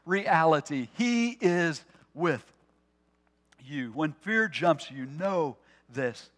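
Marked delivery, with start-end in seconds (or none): none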